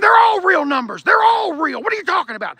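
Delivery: high shouted protest; retold angry shout. Son shouting at dad about car numbers, high angry shout. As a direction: yell it at him in disbelief. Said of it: "Like, I was pissed".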